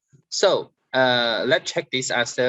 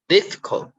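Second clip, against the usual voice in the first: "difficult" with DIF raised; stress on first syllable; 7 semitones higher than usual. Shown as a demonstration. Teacher giving an example of word stress, first-syllable stress on "difficult". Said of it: "you stress on it".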